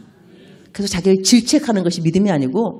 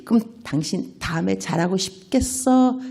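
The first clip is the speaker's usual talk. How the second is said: ordinary talk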